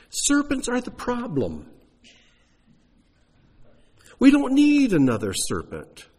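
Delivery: high, loud incredulous objection; mock protest. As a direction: play the baffled Moses arguing back.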